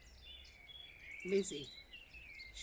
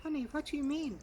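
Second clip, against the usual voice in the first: higher, louder urgent plea; frightened mother; 10 semitones higher, 6 dB louder, faster than usual. A narrator's reading. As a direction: beg the child to explain. Mother demands.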